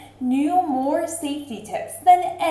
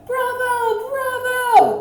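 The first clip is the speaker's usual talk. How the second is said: high excited cheer of the crowd; high, bright bravo cheering from the crowd; 13 semitones higher, much slower than usual. A narrator's reading. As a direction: call out bravo like a thrilled audience. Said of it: "they cheered".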